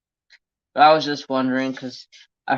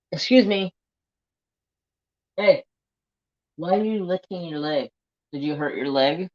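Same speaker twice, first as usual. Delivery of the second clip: pet talk; 5 semitones higher than usual. Real, not acted. Checking the pet's hurt leg.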